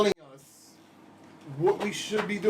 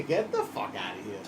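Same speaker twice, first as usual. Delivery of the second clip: tough dismissal; 9 semitones lower, 8 dB quieter than usual. Pretend. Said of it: "That's exactly what you say".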